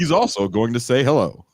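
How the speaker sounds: hammed-up newsreader parody; anchor-like kids. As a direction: announce it like a kid playing anchor. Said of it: "anchor people".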